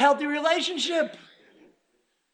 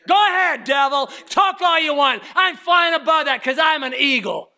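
defiant preaching; 2 semitones higher, 4 dB louder than usual. Acted. Preacher defying the devil before the church. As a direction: dare the devil to keep talking.

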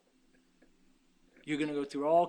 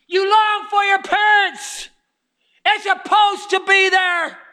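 high, shouted preaching with drawn-out words; full-force preaching; 12 semitones higher, 14 dB louder than usual. Real, not acted. Preacher tells people they long for Papa, full-force shout. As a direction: cry it out to the whole congregation.

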